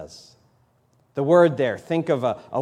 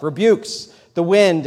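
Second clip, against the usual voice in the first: ordinary talk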